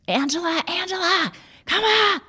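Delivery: mom's frantic high call of a name from upstairs; retold scream. Mother screaming for her daughter to come upstairs, high panicked call. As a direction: cry out for your daughter in panic, pitched high. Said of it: "I hear my mom scream my name".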